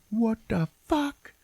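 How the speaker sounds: high, slow hammed-up astonishment; mock disbelief. Teller voices Kate hearing the timer, mock disbelief. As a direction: stretch each word high in mock bafflement. Woman's acted reaction to timer.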